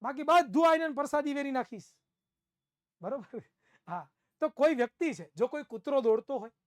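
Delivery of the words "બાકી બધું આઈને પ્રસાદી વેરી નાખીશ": begging student. Retold or imitated. Pleading student voiced.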